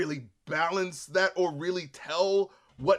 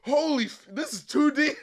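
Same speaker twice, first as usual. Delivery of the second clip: mock awe; 10 semitones higher than usual. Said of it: "I was like".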